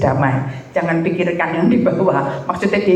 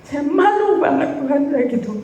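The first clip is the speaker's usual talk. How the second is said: high, tearful voice breaking; crying; 10 semitones higher than usual. Real, not acted. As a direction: speak through real tears, voice high and cracking. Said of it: "Saya nangis, bener-bener saya nangis". (menangis, suara tinggi dan pecah)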